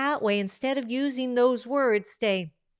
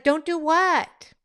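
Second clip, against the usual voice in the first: higher, slow puzzled reply; confused reply; 5 semitones higher, 4 dB louder, slower than usual. Retold or imitated. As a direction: ask it back, baffled, slowly. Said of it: "it's like". (réplica confundida, más aguda y lenta)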